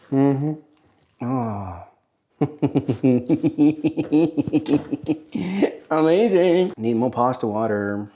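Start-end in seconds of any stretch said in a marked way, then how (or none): 2.4-5.8 long chuckle rising at the end; laugh. Real, not acted. Cook delighted with his pasta, long rising chuckle.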